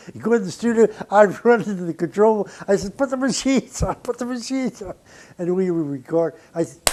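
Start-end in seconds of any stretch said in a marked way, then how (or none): 3.0-4.9 higher, hurried call to roll tape; urgent order. Retold or imitated. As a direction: get the tape running now.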